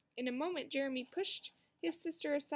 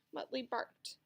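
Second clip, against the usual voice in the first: ordinary delivery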